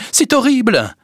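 high-pitched horrified exclamation; horrified wail; alarmed cry. A narrator's reading. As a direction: cry out the dreadful news. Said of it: "s'écria Félicité en entrant".

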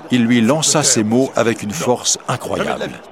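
plain reading voice

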